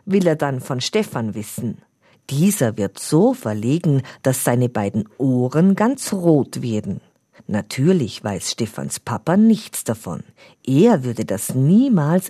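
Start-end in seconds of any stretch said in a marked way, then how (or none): none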